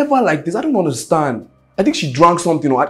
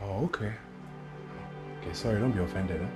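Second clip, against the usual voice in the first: soft, low backing off; mock-placating; 6 semitones lower, 17 dB quieter than usual. Man backs off after girlfriend's outburst, mock-placating. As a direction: drop the voice to placate.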